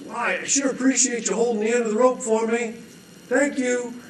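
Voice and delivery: cheerful dangling man. Acted. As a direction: sound blithely grateful from below.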